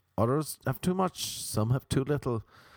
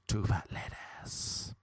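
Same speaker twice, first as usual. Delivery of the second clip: whispering; 6 semitones lower, 6 dB quieter, slower than usual. Pretend. Repeated bingo call, whispered.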